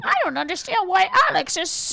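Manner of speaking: high mock baby-talk whine; baby voice people. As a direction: play a puzzled baby-talker, high-pitched. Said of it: "baby voice people would be like".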